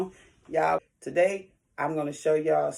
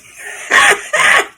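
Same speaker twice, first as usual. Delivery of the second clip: breathy laugh; 9 dB louder than usual. Real, not acted. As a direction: let out a loud airy laugh. Laughing at her own tease.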